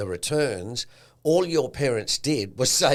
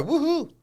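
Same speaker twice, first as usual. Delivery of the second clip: high-pitched mock woohoo; gleeful heir's whoop, pitched way up; celebratory whoop. Kids celebrating their inheritance, high mock whoop. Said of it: "and go".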